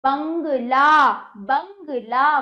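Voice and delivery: बंगला very slow, nasal बं and long ला; slow, emphatic teaching of बंगला; open-mouthed nasal demo. Shown as a demonstration. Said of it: "मुंह खोल के बोलोगे तभी इसका उच्चारण".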